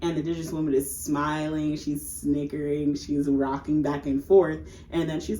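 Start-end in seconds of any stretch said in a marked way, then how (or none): none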